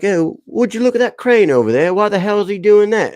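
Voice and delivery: put-on little boy's high-pitched British accent; high mock-British exclamation. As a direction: imitate a kid putting on a British accent. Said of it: "he did the British accent".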